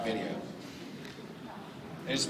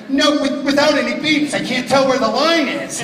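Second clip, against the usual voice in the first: higher, louder alarmed protest; panicked protest; 11 semitones higher, 7 dB louder than usual. Retold or imitated. Voice actor protesting to his director, alarmed. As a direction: push back in a rising panic.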